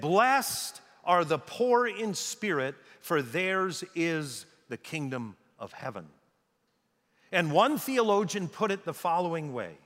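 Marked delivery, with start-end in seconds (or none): 0.0-6.1 slow solemn proclamation, phrase by phrase; slow, weighty. Retold or imitated. Preacher quoting Jesus' inaugural talk, slow and weighty. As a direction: draw it out and let each phrase land.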